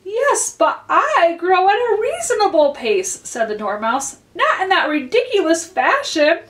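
high, huffy little voice; sulky Dormouse. A narrator's reading. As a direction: sniff at her like a sulky dormouse. Dormouse huffs at Alice.

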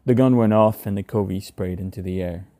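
plain reading voice

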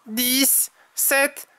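high character voice, counting uncertainly; flustered Tom. A narrator's reading. Tom counts rabbits that keep moving, high flustered voice. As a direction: count high, stumbling and correcting yourself.

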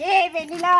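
loud call across the yard. Real, not acted. Husband calling out loudly to his wife Vennila. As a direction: call her name out loud.

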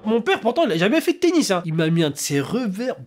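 normal talking voice

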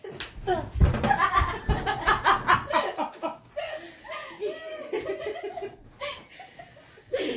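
high, helpless laughter; can't stop laughing; long laughing fit. Real, not acted.

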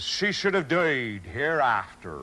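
original Elizabethan pronunciation, slow with rounded vowels; Elizabethan accent. Shown as a demonstration. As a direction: give the line in the Shakespearean-era accent, unhurried. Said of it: "It may have sounded something like this".

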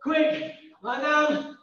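normal talking voice

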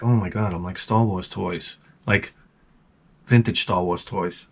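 low, soft aside to himself; hushed awe. Retold or imitated. Collector spotting toys from the driveway, hushed awe. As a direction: imitate quietly stunned amazement.

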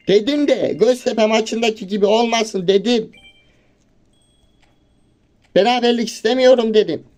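real exasperated protest; fed-up complaint. Real, not acted. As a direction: insist you didn't want a draw. Angry complaint about the draw.

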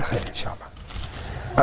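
soft, breathy, slowed murmur; quiet stunned murmur; hushed. Acted. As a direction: let it drop to a stunned hush. The man hears his friend is jailed.

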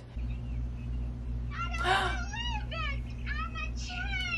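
sharp, high shocked gasp. Real, not acted. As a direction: catch your breath in shock. Reacting to the movie scene.

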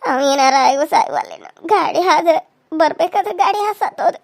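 ordinary talk, nothing marked

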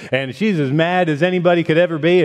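ordinary delivery

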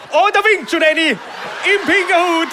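high, loud teasing shout at the audience; excited call-out. Real, not acted. Comedian calls out to Eddie in the crowd, high and teasing.

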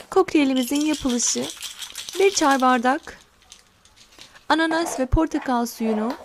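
ordinary delivery

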